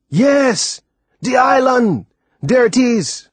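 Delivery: high, loud, joyful cry; excited laugh. Acted. Timothy gleefully shows the boy the island, excited high cry. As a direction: burst out happily at the sight.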